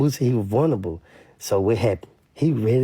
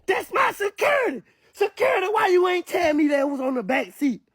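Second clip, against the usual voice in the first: panicked mock cry. [name] blurting to police about his security, high panicked mock shout. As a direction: squeal it like a hammed-up panicked tattletale.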